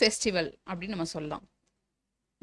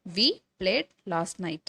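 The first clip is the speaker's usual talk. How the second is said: word by word for the class; slow dictation; much slower than usual. Shown as a demonstration. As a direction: spell it out slowly. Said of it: "teacher dictates a model sentence". (மெதுவாக சொல்லிக்காட்டுதல்)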